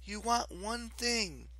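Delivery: high, slow, gentle coaxing; soothing. A narrator's reading. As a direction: lull the goddess toward sleep. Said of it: "Piper said soothingly".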